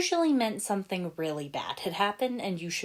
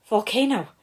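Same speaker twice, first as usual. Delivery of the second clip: breathless hiss; 5 semitones higher, 6 dB louder than usual. A narrator's reading. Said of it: "Lloyd said in a breathless hiss".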